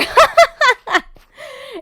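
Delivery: loud, high laugh; burst of laughter. Real, not acted. Laughs at own game struggle.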